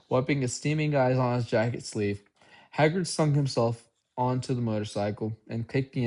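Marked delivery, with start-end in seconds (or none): none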